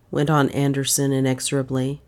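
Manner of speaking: ordinary delivery, nothing marked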